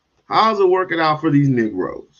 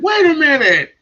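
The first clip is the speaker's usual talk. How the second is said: baffled man; 13 semitones higher, 5 dB louder than usual. Retold or imitated. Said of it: "and he's like".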